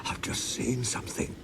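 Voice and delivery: frightened whisper; shaky whisper. A narrator's reading. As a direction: breathe it out in fright. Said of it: "said Toby in a shaky voice".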